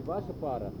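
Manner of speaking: ordinary talk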